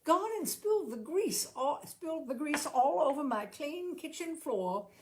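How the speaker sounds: tut-tut at Mister George, half delighted; fond scolding. A narrator's reading. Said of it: "The servant girl said".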